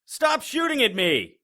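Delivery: loud, high yelling; angry shouting. Acted. Fighting a vampire while cops shoot at him, angry shouting. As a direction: shout over the gunfire.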